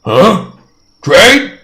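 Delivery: high, loud broken questions; a dim giant asking to trade; eager giant. Acted. Cyclops bargains.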